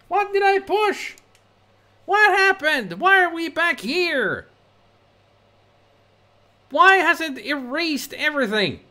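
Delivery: high, loud panicked what-happened cries; shocked disbelief. Real, not acted. Streamer losing his progress, shrill high alarm.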